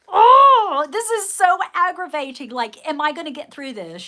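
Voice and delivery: high, loud overwrought groan that fades; dramatic exasperation. Retold or imitated. Mock-dramatic version of her own frustrated mind, high and loud. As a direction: ham up the frustration, then let it sink. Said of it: "I'm being, um, dramatic about it".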